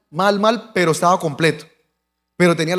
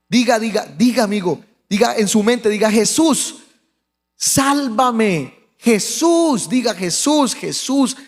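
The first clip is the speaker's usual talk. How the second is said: higher, drawn-out insistent pleading; urgent exhortation; 4 semitones higher, slower than usual. Retold or imitated. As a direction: urge a dying man to call on Jesus. Exhorta al moribundo a rezar. (exhortación insistente, suplicante)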